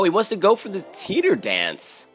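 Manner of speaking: ordinary talk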